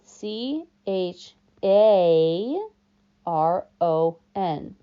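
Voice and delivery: letter by letter, very slow; spelled out slowly. A narrator's reading. Guard spelling his name, slow letters. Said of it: "Say it with me".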